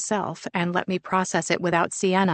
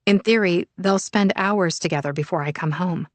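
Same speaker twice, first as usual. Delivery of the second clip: usual speaking voice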